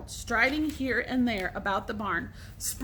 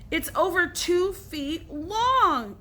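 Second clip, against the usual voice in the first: higher and slow, drawn-out yell; 7 semitones higher, 3 dB louder, much slower than usual. A narrator's reading. Billy yelling at Alan, slow drawn-out yell. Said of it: "yelled Billy".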